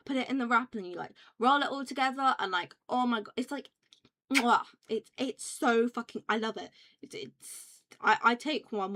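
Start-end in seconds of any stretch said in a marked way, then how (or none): 4.3-4.6 loud smacked "mwah"; chef's kiss. Pretend. Streamer praising a rolled-up snack wrap, chef's kiss. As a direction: smack a big appreciative kiss.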